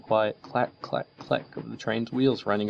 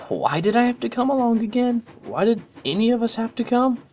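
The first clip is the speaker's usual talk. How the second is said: high, whining tomboy pony; bored complaint, pitched way up; whiny Rainbow Dash; 5 dB louder than usual. A narrator's reading. Rainbow grumbles to Twilight about the trip, high and whiny.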